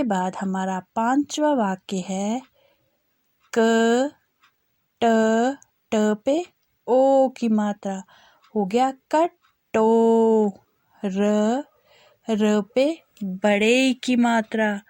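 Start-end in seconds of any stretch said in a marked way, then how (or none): 3.5-14.8 drawn-out syllables, each sounded clearly; slow spelling drill. Shown as a demonstration. Teacher spelling out a sentence for children, slow and clear. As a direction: spell it out slowly for young learners.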